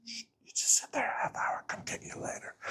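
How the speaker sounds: hushed, breathy guard whisper; secretive whisper. Retold or imitated. Temple guard sneaking the speaker into closed-off rooms, whispering. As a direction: whisper it like you're letting someone in on a secret.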